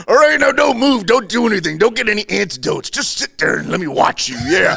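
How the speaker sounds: Randy Savage impression. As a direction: bark orders like a wrestling promo. Randy quoted threatening Jake.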